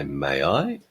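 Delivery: low, coy question rising at the end; mock flirtation. Woman to a man at a party, mock flirtation. Joke, flirting while pouring a drink.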